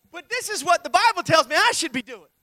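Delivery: loud pulpit proclamation; preaching loud. Acted. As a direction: hammer it home.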